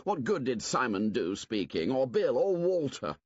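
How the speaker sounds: loud, high, fast scorn; contemptuous outburst. Acted. Jack tells the assembly to leave deciding to hunters, contemptuous. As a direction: shout Piggy down.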